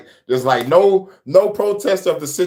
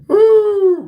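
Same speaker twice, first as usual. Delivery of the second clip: much higher, louder cry of disbelief; high laughing woo; 5 dB louder than usual. Real, not acted. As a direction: let out a laughing wail.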